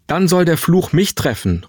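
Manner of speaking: high, forceful exclamation without shouting; energetic cry. A narrator's reading. Mother taking the curse upon herself, energetic. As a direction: call it out with resolute energy. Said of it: "rief Mutter energisch".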